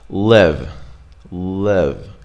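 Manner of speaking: "Live" with a lax, short ih vowel; "Live" said as LIHV, not LEEV. Shown as a demonstration. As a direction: relax the vowel of "Live", as in "sit". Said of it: "So we have a short I".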